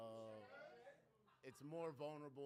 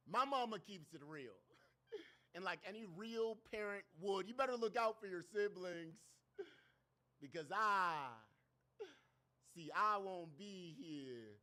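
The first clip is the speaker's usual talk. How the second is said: loud, performed spoken-word delivery; poetry recital; 8 semitones higher, 11 dB louder than usual. Acted. Poet performing a vulnerable piece to the crowd, loud spoken word.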